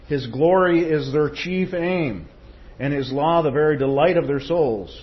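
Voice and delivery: loud, ringing pulpit reading of Spurgeon; preaching a quotation. Acted. Preacher reading Spurgeon to the church, ringing and loud. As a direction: proclaim it to the congregation with swelling force. Reads Spurgeon quote at full force.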